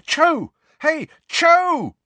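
loud high call after someone. Acted. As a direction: shout her name down the hall. Running after her, calling.

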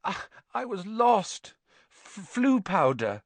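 put-on high, stammering boy; flustered stammer. A narrator's reading. Harry explains to Hagrid he was lost, flustered stammer. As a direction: stumble over it, pitched high.

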